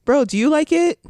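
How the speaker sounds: high, loud hyped encouragement; pep talk. Retold or imitated. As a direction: pump up a friend with big energy. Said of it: "I'm, like, always like".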